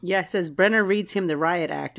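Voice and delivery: normal talking voice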